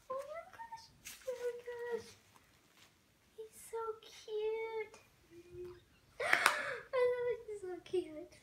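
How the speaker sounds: very high squeal building to a loud gasping cry; overjoyed squeal. Real, not acted. Vlogger revealing the doll's face at last, overjoyed.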